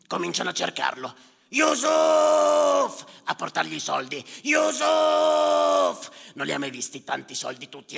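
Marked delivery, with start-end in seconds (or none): 1.5-3.1 much higher, loud shout of a name; calling out. Acted. Villagers searching for Yusuf, shouting his name. 4.4-6.2 much higher, loud shout of a name; calling out. Acted. Villagers searching for Yusuf, shouting his name.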